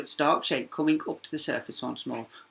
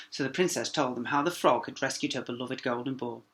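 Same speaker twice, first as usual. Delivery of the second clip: ordinary delivery, nothing marked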